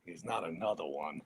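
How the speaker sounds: low, quiet grumble to oneself; muttered dismay. Retold or imitated. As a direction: grumble it under your breath in mock dismay. Retold officer groans at recruit.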